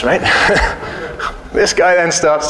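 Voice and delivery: ordinary talk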